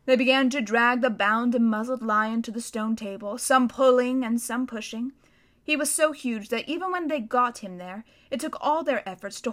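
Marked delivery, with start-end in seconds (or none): none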